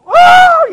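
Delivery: high, very loud whoop; screamed-out cheer. Retold or imitated. Sports fan screaming at the hotel TV, excited whoop. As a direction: let out a huge excited scream. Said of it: "I screamed out loud".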